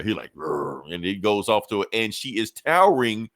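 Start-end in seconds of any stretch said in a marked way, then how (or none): 0.3-0.9 low rolled growl; imitated creature growl. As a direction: growl like a demon horse, low. Imitates the demon Pegasus.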